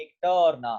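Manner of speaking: usual speaking voice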